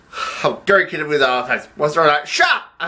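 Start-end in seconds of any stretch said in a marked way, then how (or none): 2.3-2.7 abrupt high-pitched snap; sharp outburst. Acted. Boss silencing the accountant, sharp high outburst. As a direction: cut in suddenly, much higher. Acted quarrel, sudden order.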